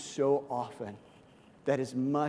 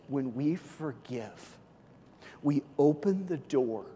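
normal talking voice

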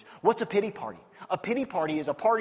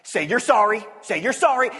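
building from firm to loud, higher scolding; officer holding the thief by the ear, getting angrier; stern police officer; 5 semitones higher, 7 dB louder than usual. Retold or imitated. Officer scolding the thief, rising to a high loud demand. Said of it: "He goes".

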